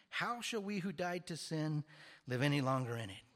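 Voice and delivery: loud, emphatic preacher's delivery; forceful preaching. Acted. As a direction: drive the point home to the congregation.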